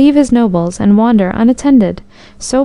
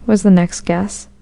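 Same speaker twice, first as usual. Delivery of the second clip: ordinary delivery, nothing marked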